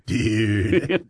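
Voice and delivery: low, drawn-out mock "dude"; mimicked "dude". Cohost teasing the host about his verbal habit, mimicked drawl. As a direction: stretch out a teasing imitation. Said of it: "you had a propensity for saying".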